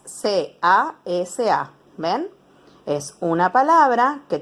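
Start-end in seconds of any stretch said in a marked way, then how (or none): 0.0-1.7 C A S A, each letter said slowly and apart; spelled letter by letter. Shown as a demonstration. Teacher to pupils, spelling the word, slow letters. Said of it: "O en letras".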